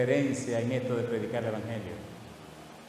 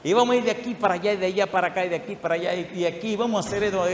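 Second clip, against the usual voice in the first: fast, higher, tumbling excited list; rushing excitement; 4 semitones higher, faster than usual. Retold or imitated. Son planning trips around the world, fast and excited. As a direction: rattle it off like an eager kid. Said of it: "Y Andrés me decía".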